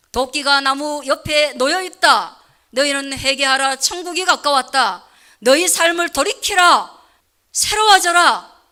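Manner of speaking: loud, slow prophetic rebuke; rebuking. Acted. Jesus rebuking a fallen world, loud and slow. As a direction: thunder the call to repent. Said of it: "분내시며 책망하시며".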